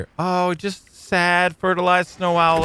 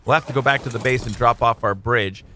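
ordinary talk, nothing marked

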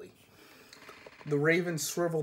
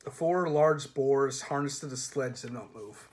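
ordinary delivery, nothing marked

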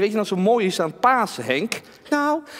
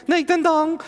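talking doll; 7 semitones higher than usual. Acted. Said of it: "Henk is mijn pratende pop".

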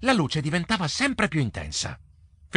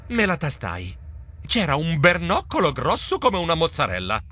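ordinary delivery

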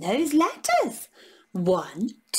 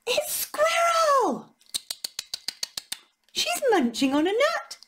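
excited reveal to the toddlers; 13 semitones higher than usual. Acted. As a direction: gasp it out in delight.

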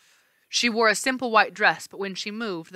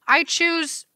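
hesitant; 10 semitones higher than usual. Acted. Annabeth hesitant.

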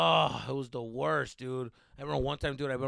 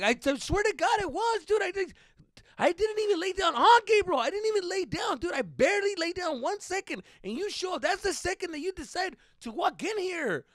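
high, loud frantic shouting at the brother; mock yell; 10 dB louder than usual. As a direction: yell it out in exasperated outrage. Retold yelling at brother.

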